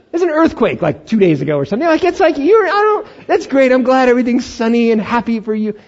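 high, loud sarcastic sputter; mock jealous rant. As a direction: sputter with comic envy.

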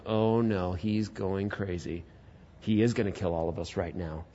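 very low, soft acted alarm; quiet, sinking realization; hushed dread. Brothers imagining they will be killed.

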